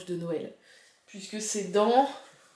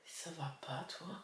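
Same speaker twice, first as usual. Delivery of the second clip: whispered tease; 3 semitones lower, 15 dB quieter than usual. Real, not acted. Teasing aside.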